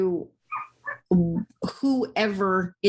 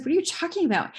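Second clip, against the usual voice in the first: baffled; 9 semitones higher, much faster than usual. Retold or imitated. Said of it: "And he's like".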